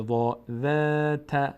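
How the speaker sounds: ذات with a thin, unrounded آ; slow recitation. Shown as a demonstration. Teacher demonstrates the light آ in ذات, slow. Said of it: "اما پر نمیشه".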